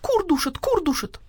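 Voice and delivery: high, repeated hen lament; wailing hens. A narrator's reading. Hens join in a chorus, high wail. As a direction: cry it high, in a thin echo. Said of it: "завопили все хором".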